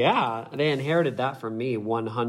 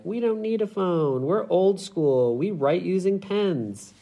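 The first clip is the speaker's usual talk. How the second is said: higher, mock-earnest voice of future teens; the guest imagines proud young people shunning phones, hammed-up; 8 semitones higher, 4 dB louder than usual. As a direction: play a smug young rebel rejecting phones. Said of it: "young people in ten years where they're like".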